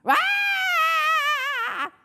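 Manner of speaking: long, high wailing scream; final scream. A narrator's reading. Children fleeing the zombie teachers, long scream. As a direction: hold a piercing shriek.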